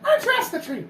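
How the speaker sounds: very high, fast sardonic voice; mock him in a squeaky bird voice; high-pitched snarky creature voice; high kenku voice. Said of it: "He says".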